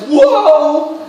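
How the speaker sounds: loud, high startled exclamation; mock startled whoa. Prisoners startled by the earthquake, loud mock shock. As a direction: jump back in playful shock. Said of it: "Paul and Silas are like".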